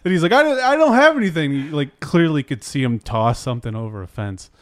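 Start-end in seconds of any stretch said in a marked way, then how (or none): 0.3-1.5 very high, loud retold denial; mock protesting. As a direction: imitate a caught man insisting he's innocent. Retold suspect denying to police.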